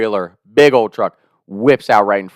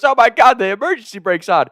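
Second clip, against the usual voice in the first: higher, startled grandma voice; surprised grandma; 11 semitones higher, 3 dB louder than usual. Retold or imitated. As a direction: gasp it out as grandma discovering the brake. Grandma realizes brake is on.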